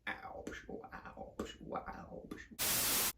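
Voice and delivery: soft breathy whisper of awe; mock whisper. Host hushing for the hutch reveal, mock whisper. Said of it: "I don't know why I'm talking like this".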